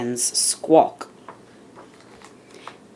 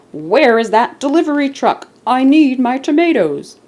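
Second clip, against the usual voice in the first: louder, higher grocer's yell; 9 semitones higher, 6 dB louder than usual. Acted. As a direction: bellow it out for the missing truck. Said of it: "and yelled".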